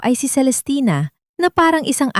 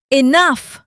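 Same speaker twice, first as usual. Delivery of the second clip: sharp, higher shout of Enough; 7 semitones higher than usual. A narrator's reading. Father silencing the maid in anger, shouting. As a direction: bark one word to end it. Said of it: "Ang sigaw ni Mino".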